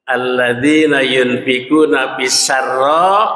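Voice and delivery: low, slow, melodic chanted verse; Quran recitation. Acted. Preacher recites the next verse to the congregation, low slow chant. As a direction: intone the verse slowly and deep.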